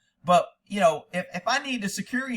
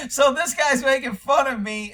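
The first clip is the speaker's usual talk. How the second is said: higher, louder, talking through a laugh; real amused laughing; 7 semitones higher, 5 dB louder than usual. Real, not acted. Host enjoying a viewer's teasing comment, laughing. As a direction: say it while still cracking up.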